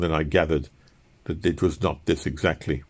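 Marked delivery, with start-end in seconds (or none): none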